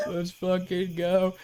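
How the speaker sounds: slow, drawn-out bro hype; frat bro. Retold or imitated. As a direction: drag it out like a partying bro. Joining the frat bro bit.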